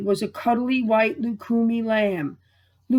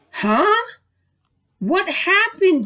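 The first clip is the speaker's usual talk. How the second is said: put-on higher, dazed little girl; confused child; 9 semitones higher than usual. Lukumi waking confused after a dream, high child voice. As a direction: wake up puzzled, pitched high. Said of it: "Lukumi said".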